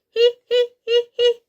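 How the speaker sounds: high, loud teasing hee-hee; monkey giggles. Acted. Monkeys in the trees teasing Jackal, high mocking giggle. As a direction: cackle like cheeky monkeys. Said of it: "they laughed".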